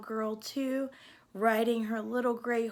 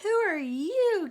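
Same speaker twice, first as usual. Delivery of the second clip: startled, higher and louder question; Cindy startled by the gunshot, gasping the question higher; gasping in surprise; 8 semitones higher, 5 dB louder than usual. Acted. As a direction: gasp it out, startled by the stranger. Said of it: "gasped Cindy Ellen".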